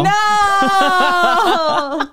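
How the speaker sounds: long, high, loud wail; drawn-out loud cry of protest. Real, not acted. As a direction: wail it out in laughing dismay. Protest at being clipped.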